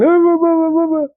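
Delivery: high squeaky mocking blah blah; mocking whiny pundit voice; mock crying news pundit.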